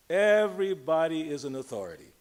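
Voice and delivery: ordinary talk, nothing marked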